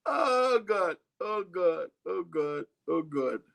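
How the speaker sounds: hammed-up crying oh God, fading down to near plain; mock terror that fades out; mock wail. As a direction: wail in pretend despair, then let it die away. Mock despair after ice cream.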